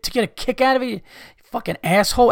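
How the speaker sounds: ordinary delivery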